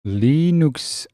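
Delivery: Linux said LEE-nooks, first syllable held long; stretched pronunciation. Shown as a demonstration. Showing pronunciation from chat.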